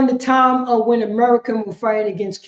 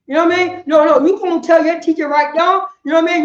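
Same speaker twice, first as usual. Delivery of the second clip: ordinary delivery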